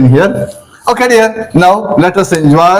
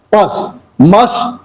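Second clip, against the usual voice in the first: ordinary delivery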